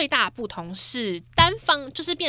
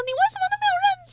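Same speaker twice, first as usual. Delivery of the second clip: much higher, very fast mock scolding; mock angry mom; 4 dB quieter, much faster than usual. Host imitating her mother scolding a zero score, shrill. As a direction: squeal it like a ranting mom. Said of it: "被我妈就是狂骂".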